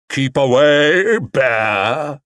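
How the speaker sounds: slow, loud, theatrical warning; dramatic. Acted. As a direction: ham up the fear. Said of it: "he said dramatically".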